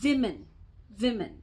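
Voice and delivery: wimin, the plural, pushed out firmly; plural pronunciation. Retold or imitated. Teaching the house help, says women as wimin. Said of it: "एक से ज़्यादा की बात".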